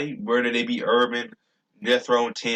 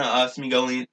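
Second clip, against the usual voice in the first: usual speaking voice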